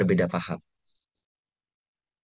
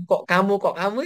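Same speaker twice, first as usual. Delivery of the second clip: mock nagging; 8 semitones higher than usual. Quoting the nagging.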